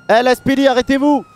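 loud, high order to stop; police command. Acted. Officer ordering a car to stop.

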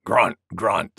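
low boar grunts; deep, short piggy grunts; grunting wild boar. A narrator's reading. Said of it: "said Wild Boar".

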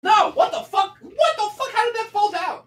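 real shock as something tumbles; shocked outcry. Real, not acted. Vlogger sees a figure fall down, high shocked yelling.

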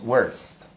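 "worst" with the O said as "er"; demonstrated English pronunciation. Shown as a demonstration. Teacher shows learners the rule, "worst" with an "er" vowel. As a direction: model "worst" as "werst", one syllable.

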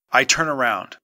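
plain reading voice

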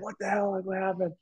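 mock stunned friends asking what happened; imitating friends caught off guard; shocked disbelief. Friends reacting to shocking news.

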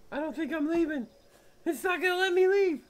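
high, loud panicked protest; genuinely rattled, pleading. Real, not acted. Player trapped in the room, panicking aloud.

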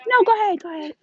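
real polite sing-out to someone nearby; high-pitched polite insistence. Real, not acted. Shopper waves another person ahead, high polite insistence.

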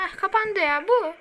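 high, loud exasperation; exasperated outburst. Real, not acted. Gamer venting at the screen, high and loud. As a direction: burst out at the game. Relief then exasperation at game.

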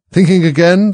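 high, loud pouncing question; prodding Duchess. A narrator's reading. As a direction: pipe up and catch her at it.

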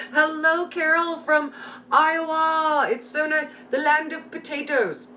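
high bright hello, slowed on the name; sing-song greeting. Real, not acted. Host greets Carol from Iowa, high and sing-song. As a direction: croon the welcome.

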